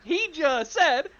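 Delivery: high, loud, amused disbelief; incredulous protest. Real, not acted. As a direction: argue it at the top of your range. Teller protests the threat reading.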